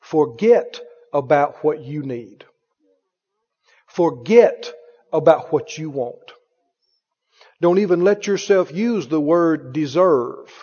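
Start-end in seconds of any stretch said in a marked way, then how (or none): 0.0-6.3 slow, with a pause after each phrase; spaced out slowly for emphasis; real slow. Real, not acted. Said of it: "say it again real slow".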